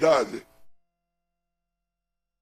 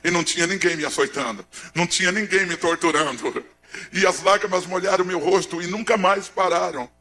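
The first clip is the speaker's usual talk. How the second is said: ordinary talk, nothing marked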